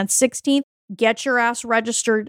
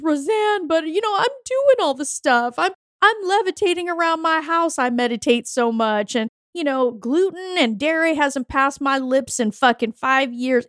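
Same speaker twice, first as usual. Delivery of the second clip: higher, complaining imitation of a frustrated woman; higher mock-whiny voice of a fed-up client; 5 semitones higher than usual. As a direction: whine it like a client who did everything right. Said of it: "Something that I hear from women all the time is".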